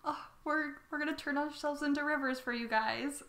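high, sweet little dragon voice; cute dragon voice. Acted. Cute dragons promise the Chinese people help, high and sweet. Adorable dragons offering themselves.

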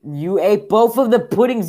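high, very loud accusation; outraged yell. Real, not acted. Player accuses game character of eating puddings, outraged yell. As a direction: explode at the greedy culprit.

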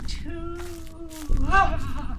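high excited squeal. Acted. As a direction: squeal with glee.

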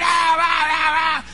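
high-pitched mob chanting shout. Retold or imitated. Employees whipping themselves up, shouting. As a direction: imitate a crowd yelling itself on. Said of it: "empezaron a gritar".

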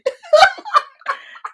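high, loud peal of laughter; real burst of laughter. Real, not acted. Host laughing with co-host over the old floor cloth: loud laughter.

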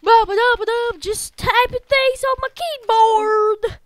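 high, loud silly sing-out; playful loud chatter. Pretend. Player fills the typing time, silly and loud.

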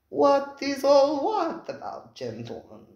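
slow, sing-song purring host. Acted. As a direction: purr it out, silky and slow. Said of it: "purred the voice".

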